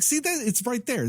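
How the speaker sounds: high-pitched mock "gotcha"; teasing pointing-out. Siblings ribbing him over his reaction, teasing pointing-out.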